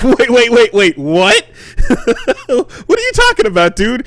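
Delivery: high, loud, incredulous retold reaction; stunned disbelief. Tourist reacts to a stranger's dark warning, stunned disbelief. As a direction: act out being thrown, pitch shooting up.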